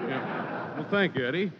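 sniffly mock crying. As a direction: sniffle through a meek thank you. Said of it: "stop crying".

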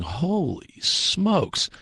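long drawn-out awe, pitched high; slow, high-pitched marvelling; retold amazement. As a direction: stretch it out in wonder.

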